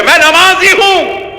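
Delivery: mock proud claim. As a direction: stretch it out with mock pride. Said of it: "بڑے فخر سے کہہ رہے".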